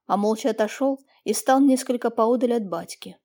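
plain reading voice

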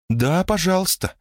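cartoon princess. A narrator's reading. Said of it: "отозвалась Элоиза".

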